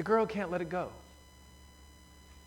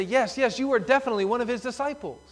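higher, fast, pressing the point; quick, raised voice piling on; eager accusation; 6 semitones higher, 3 dB louder than usual. Retold or imitated. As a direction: crowd sure they've caught him. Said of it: "they say".

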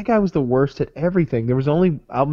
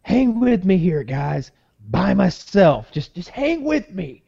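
slow, high-pitched mock pleading from an overwhelmed worker; stressed-out waiter; 6 semitones higher, slower than usual. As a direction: imitate a frazzled waiter, higher and drawn out. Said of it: "he was really stressed out by it".

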